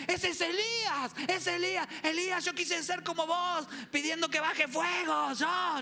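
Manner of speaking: loud, high, drawn-out fan's outburst; starstruck shouting. Acted. Disciple thrilled to see Elijah, high excited shouting. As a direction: gush loudly at meeting your idol.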